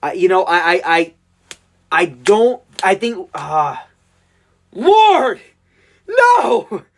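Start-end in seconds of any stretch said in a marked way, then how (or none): 4.7-6.8 anguished cry. Real, not acted. Dreading rival pick.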